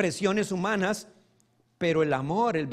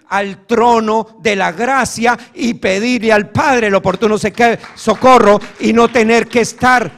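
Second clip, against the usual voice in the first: full-force preaching; 4 semitones higher, 13 dB louder than usual. Real, not acted. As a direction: drive it home to the congregation.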